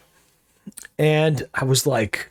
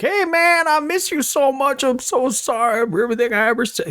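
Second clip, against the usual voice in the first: wasted dude; 11 semitones higher than usual. Retold or imitated. Two wasted dudes at a bar, drunk gushing apology. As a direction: slur out a sloppy drunk apology. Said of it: "like these two wasted dudes".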